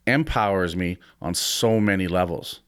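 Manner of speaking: normal talking voice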